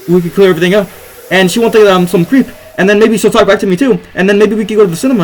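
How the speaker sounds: getting carried away by a happy fantasy; giddy rush. Real, not acted. Excited daydream about her.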